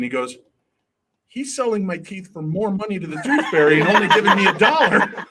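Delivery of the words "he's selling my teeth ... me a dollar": higher, louder indignant kid imitation; mock child outrage. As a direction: build to an aggrieved punchline. Retold child's outraged punchline.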